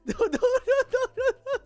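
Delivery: giggling denial; laughing no. Real, not acted. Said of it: "laughing denial of own claim".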